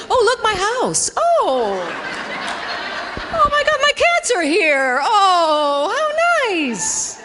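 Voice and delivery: high, sing-song delighted voice; dreamy distracted self. Comedian acting how cats distract her at the door, high cooing. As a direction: coo it like you've forgotten everything else. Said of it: "was like".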